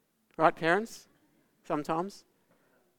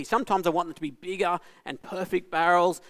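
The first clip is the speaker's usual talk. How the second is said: ordinary delivery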